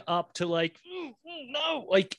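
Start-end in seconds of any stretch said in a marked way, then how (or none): 0.9-1.9 high, soft squeals into a no; high-pitched character refusal; mock escalating refusal. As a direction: mimic squeamish protest in a high voice. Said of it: "every scene of him amping up".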